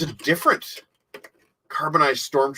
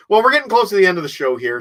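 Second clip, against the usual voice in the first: fast, higher and louder sign-off; upbeat host; 6 semitones higher, 6 dB louder, much faster than usual. Real, not acted. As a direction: rush into the wrap-up brightly. Host wraps up show.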